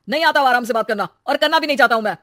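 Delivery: loud, heated outburst; furious husband. Acted. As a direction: snap back at full volume. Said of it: "गुस्से में बदल गया था". (गुस्से में भड़कता पति)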